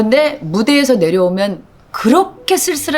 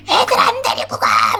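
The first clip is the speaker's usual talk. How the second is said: sharp yell, much higher than her usual voice; yelling at the kids. Retold or imitated. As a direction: snap at the kids to get out of her sleep. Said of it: "막 이러면서 소리를 지르고".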